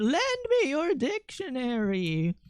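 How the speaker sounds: "Lend me your dictionary" high, soft and very slow, rising; specific cadence. Shown as a demonstration. Repeating the code phrase to chat, slow and high. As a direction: croon it slow and high, like a spell. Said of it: "say it in that specific cadence".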